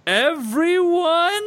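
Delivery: high, stretched Gary Oldman scream; Gary Oldman yell. Shown as a demonstration. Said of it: "It's my best Gary Oldman guy".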